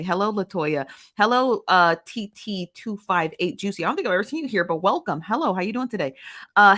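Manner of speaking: ordinary talk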